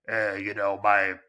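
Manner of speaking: mock noir voice, jaded gumshoe telling his sad backstory; grizzled noir detective. Speaker parodies the grizzled private eye cliché, mock noir voice. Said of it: "I talk in a noir voice".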